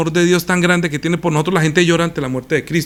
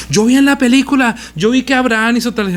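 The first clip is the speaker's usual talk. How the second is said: mocking an evangelist; 8 semitones higher than usual. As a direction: parody the gullible preacher, pitched way up. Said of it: "un señor que era un evangelista y decía".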